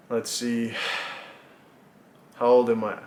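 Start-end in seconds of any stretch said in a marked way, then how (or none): none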